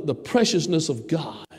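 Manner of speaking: usual speaking voice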